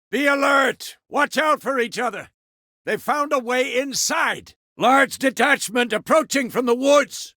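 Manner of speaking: high, projected battle call; shouted combat instructions. Acted. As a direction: call out orders over a fight. Shouting orders and warnings in combat.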